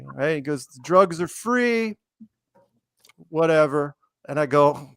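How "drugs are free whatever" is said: high, slow imitation of a stoner; dreamy, drawn-out mock stoner; stoned man. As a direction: stretch it out like someone spaced out. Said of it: "high as a freaking kite".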